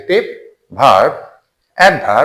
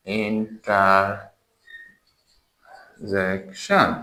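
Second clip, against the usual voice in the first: interjection drawn out extremely slowly, in separate syllables; very slow, soft breakdown of interjection by syllable; 3 semitones lower, 8 dB quieter, much slower than usual. Shown as a demonstration. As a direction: softly stretch interjection, long gaps between syllables. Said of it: "syllable করে".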